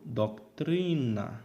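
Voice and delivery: "doutrina" with a long, stressed i; tônica na penúltima. Shown as a demonstration. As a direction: draw out the middle syllable: douTRIIIna. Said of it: "Temos um I longo aqui". ("doutrina" com o i longo e tônico)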